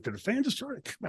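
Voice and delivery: hushed amazement, as if to himself; disbelieving thought. Retold or imitated. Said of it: "I'm thinking".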